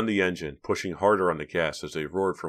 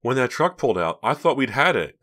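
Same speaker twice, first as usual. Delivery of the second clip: higher, louder, lively; keyed-up and pitched up; excited relief; 7 semitones higher, 7 dB louder than usual. A narrator's reading. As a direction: let the relief spill out. Breathless relief after narrow escape.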